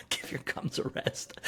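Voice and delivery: quoted in a soft, voiceless whisper; amused hushed repeat. Retold or imitated. Host relishing a comic line, amused hush. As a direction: breathe the quote out softly after a laugh.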